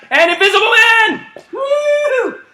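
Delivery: very high, loud call and whoop; excited whoop. Real, not acted. Coach calling out while running: high excited whoop. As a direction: let it fly up high.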